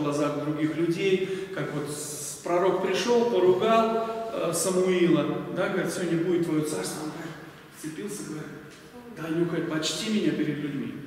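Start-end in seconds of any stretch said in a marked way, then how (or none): none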